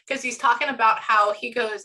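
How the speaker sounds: ordinary talk, nothing marked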